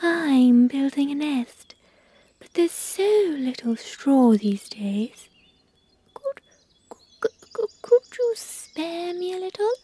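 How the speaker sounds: a shy little bird asking a favour, stuttering; Blackbird. Acted. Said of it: "Blackbird said".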